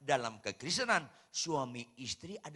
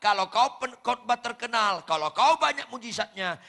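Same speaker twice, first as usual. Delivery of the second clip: loud, much higher shouted rebuke; preaching shout; 11 semitones higher, 15 dB louder than usual. Retold or imitated. Pastor shouting a warning at fellow pastors at a synod meeting, loud rebuke. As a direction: thunder it at the pastors.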